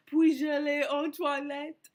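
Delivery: loud, high, drawn-out French; exaggerated French imitation; put-on French. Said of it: "it was in French".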